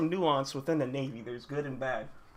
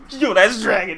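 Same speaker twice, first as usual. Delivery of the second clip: loud, higher burst of disbelief; excited shout; 5 semitones higher, 13 dB louder than usual. Real, not acted. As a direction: shout it out in amazed recognition. Recognising Dragon with growing shock.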